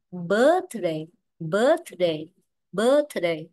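"birthday" with a long, stressed first syllable; teacher drilling "birthday" as BIRTH-day. Shown as a demonstration. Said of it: "trọng âm rơi vào nó".

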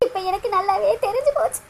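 softly, through tears, தெரிஞ்சு sinking low; quiet sobbing. Acted. Priya alone, grieving her lost love, quietly sobbing. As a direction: murmur it while weeping, falling away.

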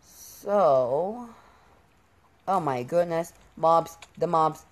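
ordinary talk

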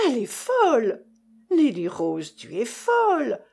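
loud, high shocked mother; shocked outcry. A narrator's reading. Mother hears daughter's refusal, loud shocked outcry. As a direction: throw up your hands in disbelief.

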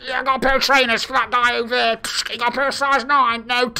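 mock walkie-talkie voice. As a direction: rattle it off like staff on a radio.

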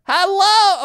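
high, loud hail; loud questioning holler; calling out. Real, not acted. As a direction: shout up to someone far above. Calling to someone way up there.

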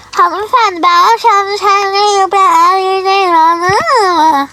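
slow, loud and emphatic; loud insistence. Acted. Customer loudly insisting to the counter clerk, emphatic. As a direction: lay it down firmly to the clerk.

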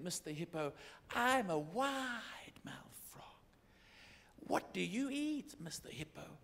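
lightly voiced frog, half whispered; a little frog whispering its question. Frog asks the hippo what he eats, lightly voiced. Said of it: "and he said".

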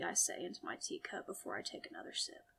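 usual speaking voice

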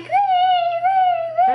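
high, loud kettle whistle wee; whistle imitation. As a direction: squeal like a boiling kettle. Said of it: "You can hear the whistle".

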